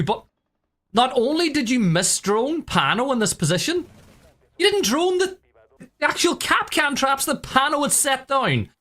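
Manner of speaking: exasperated at the missed drones; esports caster. Real, not acted. Caster scolding the team's blunder.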